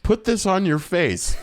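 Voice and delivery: high, giggling mock order; hammed-up laughing pilot line; laughing quote. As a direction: laugh through the pilot's silly instruction. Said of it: "Phoebe and says".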